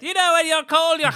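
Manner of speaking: high, laughing pompous boss pitch; gravelly old tycoon. Pretend. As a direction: explain it like a blustering old boss.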